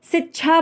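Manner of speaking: शिक्षा mispronounced as 'sichchha'; wrong pronunciation. Shown as a demonstration. Teacher quoting a learner's mistake, mispronunciation demo. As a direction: say शिक्षा with 's' and 'chchha'. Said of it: "गलत उच्चारण".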